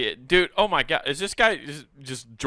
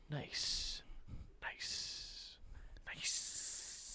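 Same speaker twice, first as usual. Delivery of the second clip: hushed whisper; 21 dB quieter, much slower than usual. Pretend. Board members approve in hushed voices.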